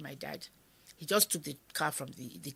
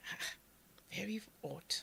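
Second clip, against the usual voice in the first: hushed whisper; 4 semitones higher, 9 dB quieter than usual. Retold or imitated. Eerie silence in the house.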